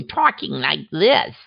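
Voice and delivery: imitating a badly faked American accent; mock a foreigner trying his best to sound American; horrible American accent. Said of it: "he's got this horrible accent".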